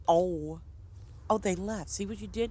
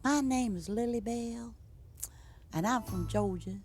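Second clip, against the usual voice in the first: put-on Southern accent; 5 semitones higher than usual. Streamer jokingly playing a peach-pie maker from Georgia, Southern accent. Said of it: "that funny Southern accent".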